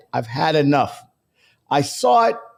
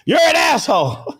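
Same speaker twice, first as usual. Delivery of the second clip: higher, louder playful insult yell; mock yell; 10 semitones higher, 4 dB louder than usual. Teasing a viewer with a loud mock insult. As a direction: bark it like a joke insult. Yelling insult, then laughing.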